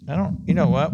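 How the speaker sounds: normal talking voice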